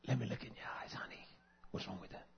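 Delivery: soft whisper to a loved one; tender whisper. Retold or imitated. Wife whispering to her husband, asking to see his eyes, tender whisper. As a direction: breathe it gently to your husband. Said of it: "you want to say".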